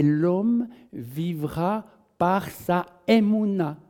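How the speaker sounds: slow, weighty scripture reading; slow, reverent quoting of the Bible; solemn verse recitation. Acted. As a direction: intone the verse like a pronouncement.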